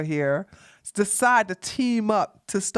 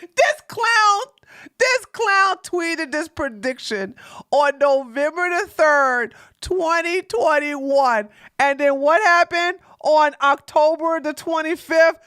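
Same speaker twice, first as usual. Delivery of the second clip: high, raised voice building to a shout; loud, scornful high-pitched proclamation; incredulous mockery; 7 semitones higher, 5 dB louder than usual. Real, not acted. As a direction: announce the reveal loudly, drawn out at the end. Mocking failed prediction, loud reveal.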